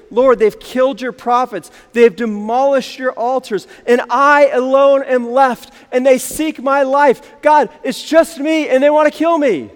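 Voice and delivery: building into an acted cry to God. Elijah alone cries out to the Lord, crying out. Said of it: "Elijah's crying out to the Lord".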